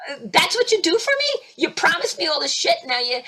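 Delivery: high-pitched indignant complaint; retold angry rant. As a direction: complain like you were let down. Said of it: "you should hear some of my conversations with him".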